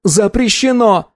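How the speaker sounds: high, drawn-out cry; boy's outcry. Acted. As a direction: stretch out a high, frantic ban. Boy repeats ban, near collapse.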